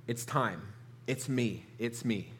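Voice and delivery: low, soft, halting confession; overcome with emotion. Retold or imitated. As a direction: reveal it quietly, pausing between phrases. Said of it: "he's overcome with emotion".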